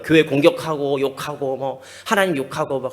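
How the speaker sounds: ordinary talk, nothing marked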